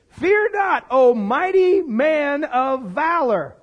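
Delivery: slow, ringing angelic announcement; high opening, then stately and deliberate; grand proclamation. Acted. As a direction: proclaim it in earnest, drawing out each word. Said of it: "He's not being sarcastic".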